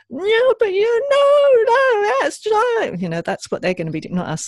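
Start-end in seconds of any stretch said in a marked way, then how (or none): 0.1-3.0 high, slow, whiny mock protest; mock parent protest. Mother mimicking a parent slipping into protest, high and whiny. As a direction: stretch it out in a high squeaky whine. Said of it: "tend to go into then the".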